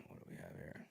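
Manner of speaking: whispered, to himself; muttered to self. Real, not acted. Looking for the next topic, whispered to himself. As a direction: mumble it while scanning.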